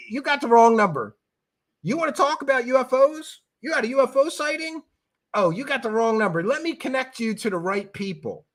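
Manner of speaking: lightly voiced bored clerk redirecting a call; mock phone brush-off, no accent. Nick Pope telling UFO callers wrong number, breezy mock voice. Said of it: "I should do it in a British accent, but I won't".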